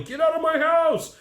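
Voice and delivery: high-pitched mock yell from the background; retold yelling. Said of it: "The guy in the background is yelling".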